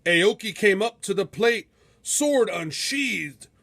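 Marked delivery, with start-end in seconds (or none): none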